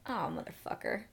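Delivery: usual speaking voice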